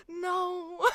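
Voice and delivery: gasp, then wail in comic dismay; mock dismayed wail. Performer hearing their own bad British accent, mock dismayed wail.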